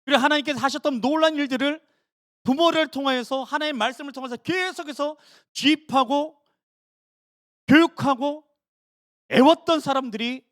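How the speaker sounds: preacher at full force; fiery preaching. Acted. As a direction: drive the point home from the pulpit.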